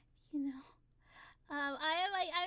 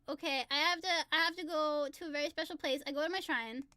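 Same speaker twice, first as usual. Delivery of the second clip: ordinary talk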